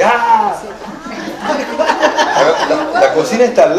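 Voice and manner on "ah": loud, high mock scream of a spoiled kid; brief hammed-up shriek; crazed yell. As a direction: let out a wild childish yell. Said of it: "pero loco, así".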